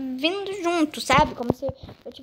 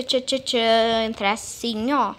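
ordinary talk, nothing marked

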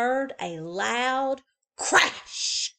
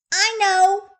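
put-on high, loud little-girl voice; childish chirp; 12 semitones higher, 11 dB louder than usual. A narrator's reading. Little girl answering her mother, high and loud. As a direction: chirp it cheerfully.

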